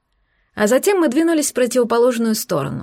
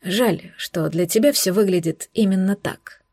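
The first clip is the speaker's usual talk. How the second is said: tired sigh. Acted. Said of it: "с усталым вздохом выдал".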